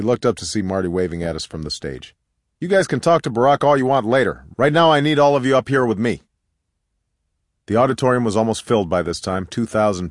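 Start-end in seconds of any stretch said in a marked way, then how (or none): 2.6-6.2 raised, higher summoning voice; calling from afar. A narrator's reading. Organizer summoning the women up to the stage, calling out. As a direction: project it to people across the hall.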